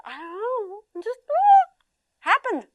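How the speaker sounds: put-on high, small child's voice, lost and embarrassed; a child admitting he's embarrassed; kid voice. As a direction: sound like a kid who can't explain it. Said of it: "They're telling the truth".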